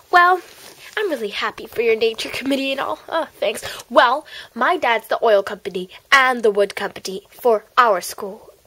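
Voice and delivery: ordinary delivery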